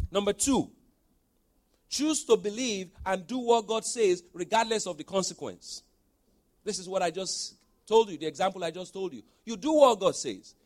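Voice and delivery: loud, high preaching push. Acted. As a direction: drive it home to the congregation. Preaching at full force.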